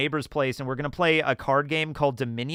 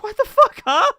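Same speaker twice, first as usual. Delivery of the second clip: much higher, louder startled yell; shocked outburst; 8 dB louder than usual. Retold or imitated. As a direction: blurt it out in total disbelief. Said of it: "I'm like".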